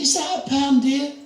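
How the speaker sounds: high sweet granny query; old lady question. Retold or imitated. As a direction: peer uncertainly like a nearly blind granny. Elderly lady voiced in story.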